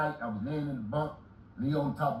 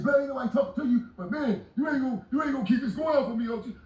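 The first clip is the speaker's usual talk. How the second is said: higher, fast mimic of the young man; young cellmate arguing, higher and fast; cellmate talking back; 8 semitones higher, faster than usual. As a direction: rattle it off like the cellmate. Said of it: "He said".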